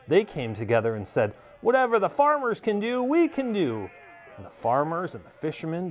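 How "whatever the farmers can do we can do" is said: high, angry vow; 怒った宣言; furious declaration. A narrator's reading. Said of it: "that made the fishermen furious".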